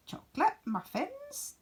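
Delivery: very high, rising rabbit squeak; high squeaky rabbit question. Acted. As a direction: squeak it in doubt. Rabbit sniffs the muffins.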